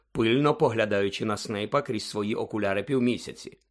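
usual speaking voice

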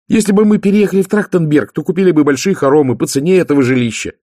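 high, loud, excited pitch of an idea; youngest sister, eager. Acted. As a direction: propose it as the keen little sister.

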